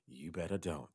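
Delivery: low and soft, sinking to a whisper; hushed catchphrase. Acted. Storyteller naming the urban legend, low hushed warning. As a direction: drop down and end in a whisper.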